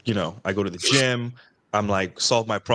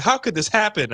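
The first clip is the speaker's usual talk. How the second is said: high-pitched mock whine of a confused guy; 11 semitones higher than usual. Guys mocked for whining they get no sex. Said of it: "they're like".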